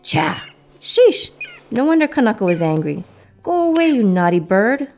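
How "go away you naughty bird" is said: higher, firm storybook scolding; mildly cross telling-off; scolding dismissal. A narrator's reading. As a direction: send the naughty bird away with raised pitch.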